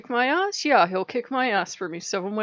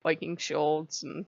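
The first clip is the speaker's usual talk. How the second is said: usual speaking voice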